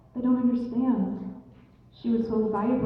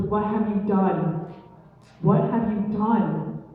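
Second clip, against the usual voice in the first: slow, emphatic, louder demand; stern accusation; 4 dB louder, slower than usual. Acted. Blue Jay confronting the man over his wife, slow stern accusation. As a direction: drag out each word of the accusation.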